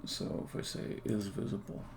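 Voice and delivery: quiet, half-whispered self-talk; muttering to himself. Real, not acted. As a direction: mumble it under your breath while working. Muttering to himself while coding.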